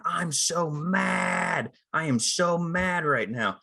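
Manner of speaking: drawn-out, high-pitched mock "so mad"; hammed-up, slow declaration of being mad; mock indicated anger. Mocks actor indicating anger mid-argument.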